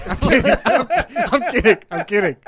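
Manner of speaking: loud, high-pitched laughing backpedal; laughing protest. Real, not acted. Co-host takes back a joke to his partner, loud and laughing. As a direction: shout it through real laughter.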